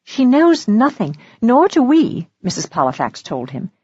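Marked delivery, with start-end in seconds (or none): 0.0-2.3 lightly voiced woman, high and slow; older woman ally. Woman answering for the confused girl, high and firm. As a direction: lift the pitch for her firm answer. Narrator voices Missus Polifax.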